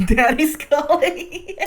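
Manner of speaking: laughing taunt. Retold or imitated.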